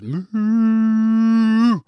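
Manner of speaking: long, high, drawn-out moo; sustained high cow moo in place of a word; cow's moo. A narrator's reading. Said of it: "verbally all that came out".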